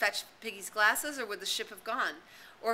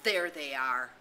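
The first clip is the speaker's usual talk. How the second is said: lower, louder bitter remark; 4 semitones lower, 6 dB louder, slower than usual. A narrator's reading. Ralph spotting the hunters, bitter and low. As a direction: say it with cold resentment. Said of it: "the bitterness of his voice".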